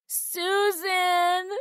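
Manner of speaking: put-on high, loud child's cry for his sister; little brother crying out for his big sister. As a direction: call out from down the hall, upset. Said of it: "cry out".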